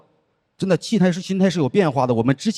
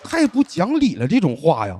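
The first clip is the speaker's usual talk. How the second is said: exasperated. Real, not acted. Exasperated comment on absurd phrase.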